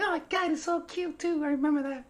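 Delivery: high, mock girly voice teasing from off camera; a mock cooing girl; imitating the girl. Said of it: "She's like".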